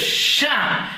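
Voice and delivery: a voiceless, loud "Shah"; shushing. Retold or imitated. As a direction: hush him sharply with a breathy "Shah". Brisker seems to shush him.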